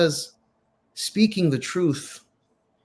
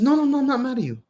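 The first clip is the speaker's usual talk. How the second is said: higher, lightly voiced reassuring sheikh; a gentle, higher no, no, no; 9 semitones higher, much faster than usual. Sheikh calmly reassures the student, lightly higher. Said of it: "He said".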